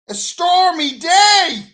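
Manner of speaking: comic shout of a stormy day; shouted repeat. Acted. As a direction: bellow it out in mock outrage.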